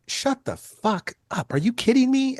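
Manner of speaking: high, drawn-out disbelief; stunned disbelief. Retold or imitated. Photographer told he will shoot [name], in stunned disbelief. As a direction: imitate being floored by great news.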